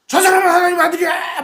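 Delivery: high shout half laughing; possessed man shouting, played for laughs; laughing mock shout. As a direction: shout it while chuckling at the idea. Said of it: "막 이렇게 소리 지르면".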